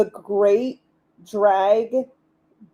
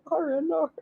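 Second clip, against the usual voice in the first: high, softer mock-Australian accent; giggly exaggerated Australian accent; 7 semitones higher, 6 dB quieter than usual. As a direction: repeat the Aussie caricature through a laugh. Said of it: "all I can do for Australian".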